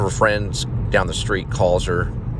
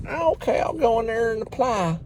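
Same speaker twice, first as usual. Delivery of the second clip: put-on kid voice. Young self agreeing.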